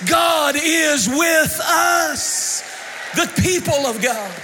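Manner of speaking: loud, high, soaring preacher's cry; full-force preaching. Acted. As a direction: preach it to the rafters with music behind.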